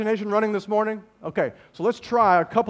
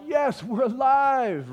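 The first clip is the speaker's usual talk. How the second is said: acted celebration, slow and higher; mock jubilant; 6 semitones higher, much slower than usual. Disciples high-fiving each other, jubilant. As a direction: stretch it out like a winner's cheer. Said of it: "they're high-fiving each other like".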